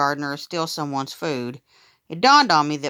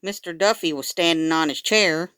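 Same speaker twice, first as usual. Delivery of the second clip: ordinary delivery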